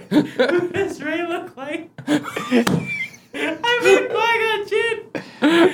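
high, slow words through laughter; giggling while admitting the mix-up; laughing. Real, not acted. Laughing at own mistake.

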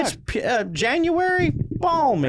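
put-on high, slow Minnesota-accented marvel; hammed-up Minnesota accent; mock Minnesota accent. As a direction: put on a chirpy Minnesota lilt. Mock Minnesota about snow.

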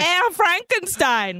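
slow, high-pitched mock German accent; attempted German accent. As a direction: put on a hammy German accent. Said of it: "trying to do a German accent".

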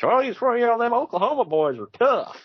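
high-pitched mock commentator tirade; imitated hometown-proud commentator, high and insistent; Jim Ross. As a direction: pitch it way up, mocking a gushing announcer. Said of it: "Jim Ross goes on a tirade about it".

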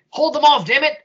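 high, loud shout while running; desperate shout. Acted. Johnson fleeing and shouting to his men, desperate. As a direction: yell back over the shoulder.

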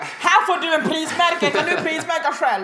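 hammed-up exasperated comeback; loud sarcastic retort. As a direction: shout back at an annoying customer. Mock retort to loudly complaining customer.

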